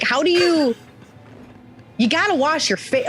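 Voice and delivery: can't believe he never washes; incredulous disbelief. Retold or imitated. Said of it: "I'm like".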